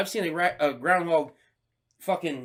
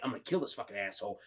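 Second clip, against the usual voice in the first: low, soft, fast murderous murmur; menacing murmur; 9 semitones lower, 13 dB quieter, faster than usual. Retold or imitated. Arguing with his conscience over the groundhog, a low soft fast murmur. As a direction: say it under your breath, deadly intent.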